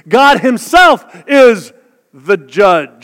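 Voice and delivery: loud, slow preaching shout; shouted proclamation. Real, not acted. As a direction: proclaim it slowly at full voice. Proclaiming scripture.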